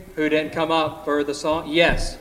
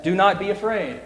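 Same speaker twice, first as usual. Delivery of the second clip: solemn reading. A narrator's reading. Reading angel's message slowly.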